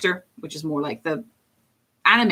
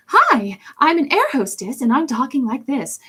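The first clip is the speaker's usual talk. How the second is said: much higher, polite air hostess voice; Momo air hostess voice; 8 semitones higher, 3 dB louder than usual. Shown as a demonstration. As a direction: chirp it like a flight attendant greeting. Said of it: "she's very, like".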